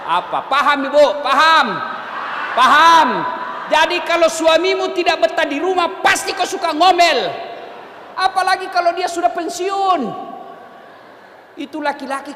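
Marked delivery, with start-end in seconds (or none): 0.5-3.1 louder each time, pressing the crowd; building loud question. Real, not acted. Preacher presses the audience. 3.7-10.0 louder, higher scolding sermon; forceful preaching. Real, not acted.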